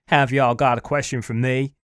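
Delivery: a deliberately bad, hammed-up American southern accent. Reviewer mimics the guy's southern accent, badly on purpose. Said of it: "he's got, like, this southern accent".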